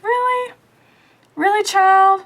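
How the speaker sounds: incredulous. Real, not acted. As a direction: squeal out real disbelief at the mess. Disbelief at bad highlights.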